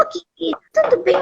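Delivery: high, soft, dainty greeting; sugary high-pitched mock greeting; sweet little voice. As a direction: imitate her cute, tender little voice. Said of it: "com aquela vozinha dela, meiguinha".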